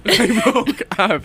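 high, drawn-out words through laughter; laughing, high-pitched protest. Real, not acted. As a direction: giggle while protesting the teasing. Laughing at being teased.

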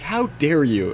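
mock outrage. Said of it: "John, being like".

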